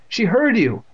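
high, loud gasp of alarm; appalled, voice shooting up; horrified cry. A narrator's reading. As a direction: cry out aghast. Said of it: "Andy cried, horrified".

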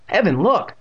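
high, loud surprised call. A narrator's reading. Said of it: "her eyes growing wide with surprise".

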